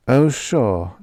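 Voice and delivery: ordinary delivery, nothing marked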